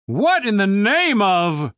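slow, drawn-out booming demand. A narrator's reading. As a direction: boom it out from up on the wall. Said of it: "The booming voice sounded familiar".

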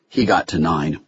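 ordinary delivery, nothing marked

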